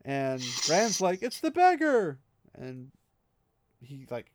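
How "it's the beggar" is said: higher, louder cry of recognition; startled outburst. Retold or imitated. Rand crying out in recognition at the beggar, startled. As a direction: blurt it out as it dawns on you.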